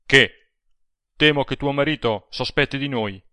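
sudden charged outburst; vibrant outburst. A narrator's reading. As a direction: burst out loudly, with intensity. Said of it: "a bassa voce, ma vibrata, proruppe".

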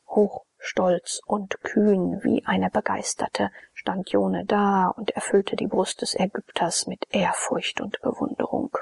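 plain reading voice

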